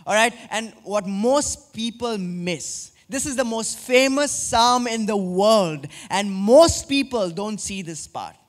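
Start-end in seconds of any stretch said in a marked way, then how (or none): none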